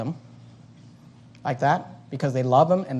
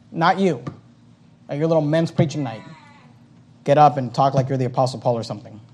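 usual speaking voice

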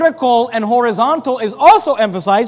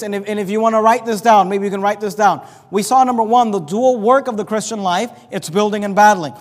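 ordinary talk

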